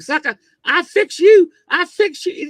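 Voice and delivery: high, loud taunting threat; threatening shout. Retold or imitated. Fighter on ground threatens opponent, high loud taunt. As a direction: taunt him like you're about to win the fight.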